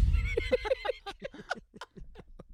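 howling laugh, pitch shooting up; loud high laugh. Real, not acted. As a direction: burst of high cackling laughter. Laughing at roast joke.

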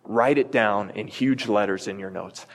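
ordinary talk

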